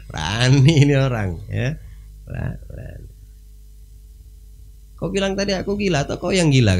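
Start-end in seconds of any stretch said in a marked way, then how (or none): 4.9-6.8 high pressing question; challenging king. Retold or imitated. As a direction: press him hard for an answer. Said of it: "kata Harun Ar-Rasyid". (raja menantang dengan nada tinggi)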